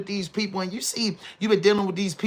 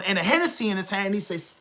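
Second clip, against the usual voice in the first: usual speaking voice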